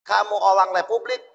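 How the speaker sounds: put-on Dutch colonial officer's accent, r said as l in orang; mock a Dutch officer questioning a villager, lightly; a hint of a Dutchman's Indonesian, olang for orang. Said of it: "Kan begitu orang Belanda ngomongnya".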